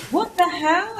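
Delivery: mock-outraged disbelief; baffled. Baffled by business voices.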